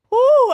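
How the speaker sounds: high loud woo of relief; loud whoop. Real, not acted. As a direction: let out a tired whoop. After exercise.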